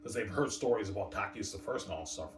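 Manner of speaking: ordinary talk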